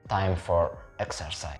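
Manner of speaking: ordinary talk, nothing marked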